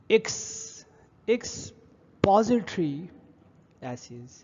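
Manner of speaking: एक्सपोजिटरी drawn out slowly, starting with a short i as in ɪks; careful pronunciation demo. Shown as a demonstration. Teacher slowly models the pronunciation for students. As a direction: spell out एक्स, then slowly एक्सपोजिटरी एसेज. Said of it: "ये बहुत short sound होता है".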